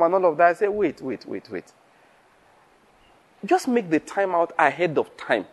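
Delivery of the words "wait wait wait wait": soft waits, trailing lower and quieter; hushed interjection. Retold or imitated. Preacher halting people's excuses for not coming, softly trailing off. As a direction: repeat it softly, letting it die away. Cutting short a caller's excuses.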